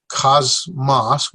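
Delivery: "cosmos" as two separate beats, KAHZ, MAHS; syllable by syllable. Shown as a demonstration. Teacher showing two syllables, "cosmos" split in halves. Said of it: "one, two syllables".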